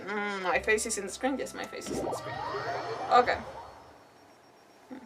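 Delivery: ordinary talk, nothing marked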